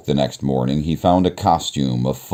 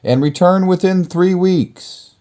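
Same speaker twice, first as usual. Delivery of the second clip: much higher, pleading plea; pleading cry; 5 dB louder than usual. Acted. Beast pleading as Beauty leaves the castle. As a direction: beg her to remember him.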